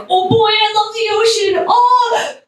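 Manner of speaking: high-pitched gush of delight; excited kid. Retold or imitated. Child at a dolphin nature special, excited. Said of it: "I'm getting excited".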